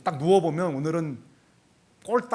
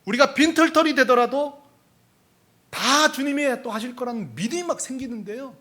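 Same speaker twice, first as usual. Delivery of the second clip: high, raised pulpit voice, loud at the start; impassioned preaching; 8 semitones higher, 5 dB louder than usual. Real, not acted. Pastor declaring trust even if left penniless, impassioned preaching. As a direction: proclaim it with rising conviction.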